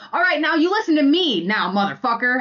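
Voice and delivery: loud, high, fierce scolding; telling him off. Retold or imitated. Wife shouting back at her yelling partner, fierce and loud. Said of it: "that is how I would talk to him".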